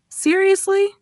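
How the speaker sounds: high, disbelieving seriously; disbelief. Shown as a demonstration. Friend failed the test after studying all night, disbelief. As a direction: sound like you can't believe it. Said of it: "and you respond".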